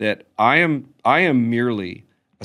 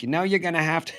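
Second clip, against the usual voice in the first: higher-pitched comic imitation of mother; put-on motherly warning, pitched up; mother's funny voice; 7 semitones higher, faster than usual. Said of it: "my mother's funny voice".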